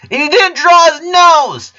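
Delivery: put-on furious little girl through clenched teeth; angry shouting girl. A narrator's reading. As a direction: scream at your twin sister, slowing at the end. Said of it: "Lola glared at her twin sister with her teeth clenched".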